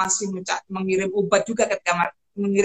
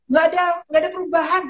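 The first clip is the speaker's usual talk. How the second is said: high, quick imitation of a patient; imitated patient report; 9 semitones higher, 4 dB louder, faster than usual. Patient reporting to her on WhatsApp, imitated high and quick. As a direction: put on a higher, hurried patient voice.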